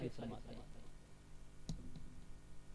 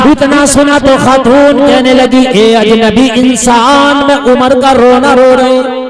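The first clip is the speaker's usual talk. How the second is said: full-force preaching; 4 semitones higher, 29 dB louder than usual. Acted. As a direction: chant the tale to the crowd, stretching the key lines. Chanted story of Umar, sermon. (Josheela was, लंबी खिंची ऊँची आवाज़)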